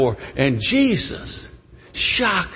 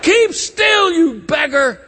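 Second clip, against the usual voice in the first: high, loud angry rebuke; scolding shout; 13 semitones higher, 4 dB louder, slower than usual. Acted. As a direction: shout the beggar down. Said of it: "They say".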